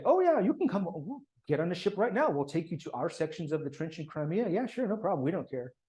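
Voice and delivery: high, breezy mock-eager welcome; breezy British offer. British officers airily inviting the American commission, high breezy mock welcome. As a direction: toss off the offer as if it costs nothing. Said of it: "the British say".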